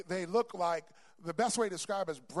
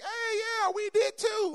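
high, loud shouting friends; rowdy friends yelling back; excited friends; 5 dB louder, slower than usual. Retold or imitated. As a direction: cheer like the old crowd. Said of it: "all my friends like".

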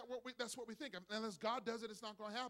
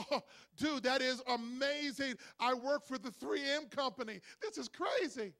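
excited dad; 4 semitones higher, 6 dB louder, slower than usual. Acted. 3M worker hears triplets.

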